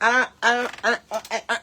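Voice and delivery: short panicky bursts, imitating a squirrel; dithering squirrel noises. Squirrel dithers in the middle of the road, frantic stuttering. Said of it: "and they're like".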